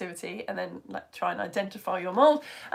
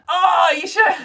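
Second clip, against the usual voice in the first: high, loud cry breaking into laughter; laughing exclamation; 8 semitones higher, 12 dB louder, slower than usual. Real, not acted. Host reacting to Tom's morning picture, laughing exclamation. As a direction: crack up loudly mid-reaction.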